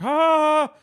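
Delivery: loud high monster shriek; monster yell. Pretend. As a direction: scream like a monster. Monster roar.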